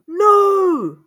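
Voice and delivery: long, high, loud cry of refusal; scream. A narrator's reading. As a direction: scream it in horror, drawn out. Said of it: "The prince screamed".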